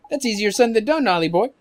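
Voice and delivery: fairy sidekick. Acted. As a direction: sidekick talking down the boy's plan.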